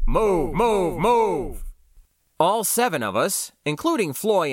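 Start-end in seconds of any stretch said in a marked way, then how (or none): 0.1-1.6 higher-pitched, repeated metallic order; trooper's amplified call, waving people away; amplified metallic shout. A narrator's reading. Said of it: "shouted in an amplified, metallic voice".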